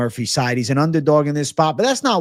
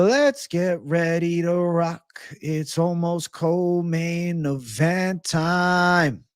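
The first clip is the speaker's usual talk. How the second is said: announcer hype; 3 semitones higher, much slower than usual. Acted. Hyping the co-main event.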